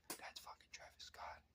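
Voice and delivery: slow hushed warning; urgent whisper. Retold or imitated. Boss quietly warning the guard who he blocked, urgent whisper. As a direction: whisper it like the boss saving you from embarrassment.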